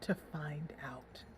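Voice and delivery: slow secretive whisper; conspiratorial. Acted. Jacob hinting at his plan to Alicia, whispered.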